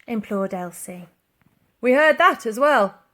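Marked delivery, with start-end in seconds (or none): 1.8-3.0 officer through door. A narrator's reading. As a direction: drag it out smugly through the door. Policeman replies through door.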